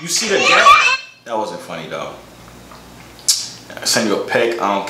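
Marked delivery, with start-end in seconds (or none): 0.0-0.7 very high laughing squeak; laughing squeal. Real, not acted.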